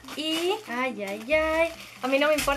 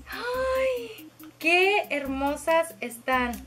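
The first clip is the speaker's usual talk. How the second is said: delighted cooing; 4 semitones higher, much slower than usual. Real, not acted. Admiring palettes.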